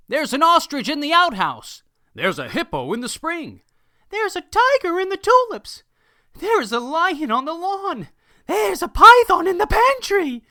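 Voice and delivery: high, loud startled cries; alarmed shouts. Acted. Startled villagers shouting about the animals they spot. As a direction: villagers yelling out each animal they find.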